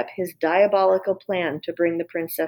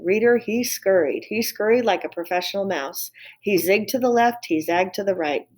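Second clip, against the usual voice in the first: ordinary delivery, nothing marked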